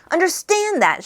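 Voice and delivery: high, loud incredulous shout; indignant shouted echo. A narrator's reading. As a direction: throw the question back at him loudly. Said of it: "shouted Arthur".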